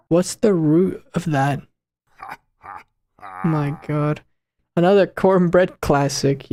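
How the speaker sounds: ordinary delivery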